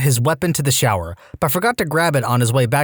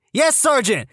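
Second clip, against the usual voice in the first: nervous recruit; 10 semitones higher than usual. Retold or imitated. Recruit answers sergeant.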